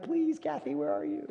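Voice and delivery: high, pleading call into the crowd; hammed-up high plea to a missing Kathy. Mock pleading for Kathy on stage.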